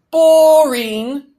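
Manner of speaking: loud, high, drawn-out singsong; cheerful toddler. A narrator's reading. Toddler reacts to house-hunting, loud high cheerful cry. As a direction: belt it out gleefully like a little kid. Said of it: "said Sam cheerfully".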